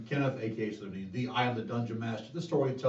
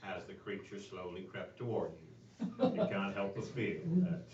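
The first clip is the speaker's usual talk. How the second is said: Morgan Freeman impression; 5 semitones lower, 10 dB quieter than usual. Host shows who narrates his role in the movie, soft narrator voice. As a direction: hush it like a documentary voiceover. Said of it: "Morgan Freeman".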